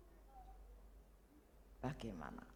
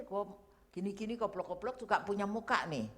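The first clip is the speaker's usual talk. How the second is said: ordinary delivery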